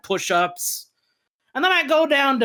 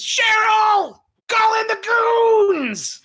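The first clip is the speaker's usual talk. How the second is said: high, loud, drawn-out shout; yelling for help; 12 semitones higher, 5 dB louder, much slower than usual. Acted. Wounded boss calls his secretary for the goons, high slow shout. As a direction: bellow for the goons, slowly.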